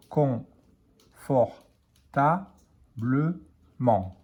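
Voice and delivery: drawn out syllable by syllable; slow teacher blending. Shown as a demonstration. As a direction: stretch "Confortablement" slowly, con-for-ta-ble-ment. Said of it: "Con, con, F-O-R".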